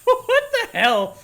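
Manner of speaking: startled high yelp of disbelief; shocked outburst. Real, not acted. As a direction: caught off guard, let it spill out slowly. Shocked by the laser traps.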